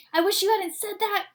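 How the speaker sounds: high, loud whiny groan. Acted. Camilla groaning at the therapist, high and loud. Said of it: "Camilla groaned".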